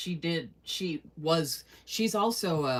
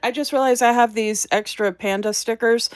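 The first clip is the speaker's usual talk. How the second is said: excited sudden idea; 4 semitones higher, 11 dB louder than usual. Real, not acted. Sudden idea for extra stickers.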